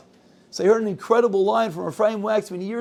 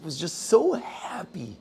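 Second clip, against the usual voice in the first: whisper; 2 semitones lower, 6 dB quieter, slower than usual. Real, not acted. Teller softens telling boy's joy.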